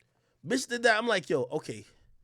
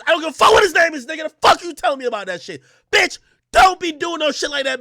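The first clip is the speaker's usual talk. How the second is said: angry scolding; 8 semitones higher, 9 dB louder, faster than usual. Retold or imitated. Scolding girlfriend.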